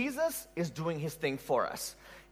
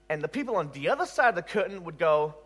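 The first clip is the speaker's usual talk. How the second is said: ordinary delivery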